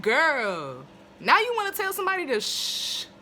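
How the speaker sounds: high, loud disbelieving call-out; exasperated outburst. Real, not acted. Reviewer scolding Faye for shushing, loud and high.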